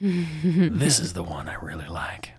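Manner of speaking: slow secret whisper. Acted. Fond aside about IMDB.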